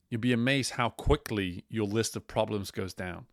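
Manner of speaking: ordinary talk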